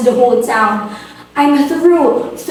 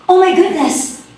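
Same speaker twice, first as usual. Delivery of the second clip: a high, sudden cry of shock; startled gasp; 6 semitones higher than usual. Acted. Hostess realizing her visitor is the Prince of Wales, shocked. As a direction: gasp it out, caught off guard.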